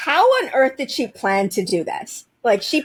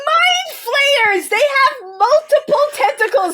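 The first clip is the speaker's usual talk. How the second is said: excited; 14 semitones higher, slower than usual. Real, not acted. Excited tentacle rant.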